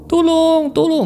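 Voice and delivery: very high, drawn-out wail; acted, desperate high call; tearful cry for help. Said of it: "Sampai dia menangis-nangis".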